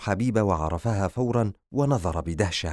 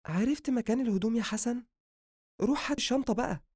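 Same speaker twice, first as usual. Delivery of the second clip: high, soft woman giving an errand; high-pitched female character; sister's female voice; 3 dB quieter, faster than usual. Acted. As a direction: send your brother off in a high female voice. Sister orders brother.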